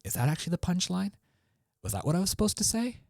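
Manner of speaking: high, fast, unsure voice; questioning uptalk. Shown as a demonstration. Comedian doubting the punchline, in high, fast uptalk. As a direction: lift every phrase like a doubtful question. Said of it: "It's like you're questioning".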